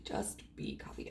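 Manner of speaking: barely voiced, low and very soft; hushed repeat. Real, not acted. Repeating it to oneself, hushed and barely voiced. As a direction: say it under your breath.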